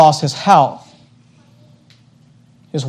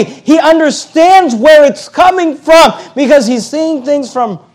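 rising preacher's cry, louder and higher; preaching at full force; 7 semitones higher, 5 dB louder than usual. Acted. Preacher urging the congregation, building to a fervent cry. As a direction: drive the point home from the pulpit.

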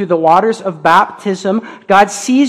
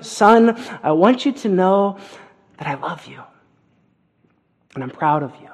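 tender. Acted. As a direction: speak it gently like a loving father. Voicing the Father to his Son.

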